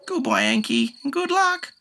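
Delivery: very high fond farewell; bird creature. A narrator's reading. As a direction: wave him off. Shishi voice.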